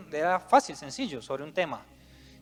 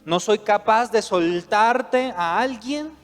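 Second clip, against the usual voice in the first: preaching at full force; 5 semitones higher, 10 dB louder than usual. Acted. Preacher voicing desperate attachment.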